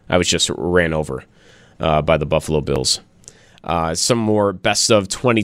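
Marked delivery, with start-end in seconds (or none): 0.1-3.0 Bill Belichick. Retold or imitated. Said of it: "That's what Bill Belichick said yesterday".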